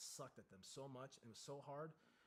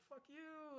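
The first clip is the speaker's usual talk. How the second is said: defiant. Retold or imitated. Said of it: "you're like".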